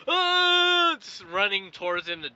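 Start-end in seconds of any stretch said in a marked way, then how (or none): 0.1-1.0 high, loud charging yell; mock battle cry. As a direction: yell like a hero charging with a sword. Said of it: "Jack does the classic".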